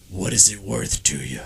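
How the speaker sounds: deep, quiet menacing rumble; imitating the Undertaker's deep voice; dead man voice. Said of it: "in the dead man voice".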